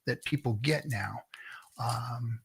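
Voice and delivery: hushed whisper, slow; a confiding whisper to chat. Real, not acted. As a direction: lean in and whisper it. Sudden hushed aside.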